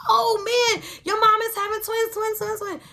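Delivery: imitating squealing friends, very high and loud; high-pitched giddy outburst; excited friends. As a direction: shriek it like thrilled classmates. Retold friends reacting to twins news.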